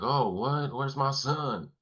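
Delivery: imitated Southern drawl, pitched high and drawn out; thick Southern accent. Father asking the guys about his son, imitated Southern accent. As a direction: put on a Southern dad's alarmed question. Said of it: "he has, like, a thick Southern accent".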